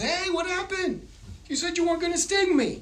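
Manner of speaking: very high, indignant frog voice; betrayed frog. Acted. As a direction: protest in a high-pitched, hammed-up squeal. Stung frog protests to scorpion.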